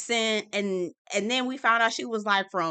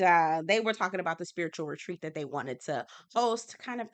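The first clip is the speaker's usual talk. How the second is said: ordinary talk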